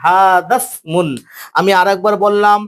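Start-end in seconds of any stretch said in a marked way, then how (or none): 0.0-1.1 হাদাসমুন said slowly as one joined word, with the alif dropped; teacher's slow model reading. Shown as a demonstration. Teacher modelling the joined reading for students, slow and clear. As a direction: draw out হাদাসমুন syllable by syllable, joined. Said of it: "ওয়াসাল আলিফটা পড়ে যাবে হাদাসমুন".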